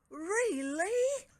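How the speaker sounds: high, loud excited gasp; excited cry. A narrator's reading. Crocus hearing news of Franz, excited cry. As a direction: burst out in excitement. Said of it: "cried Crocus excitedly".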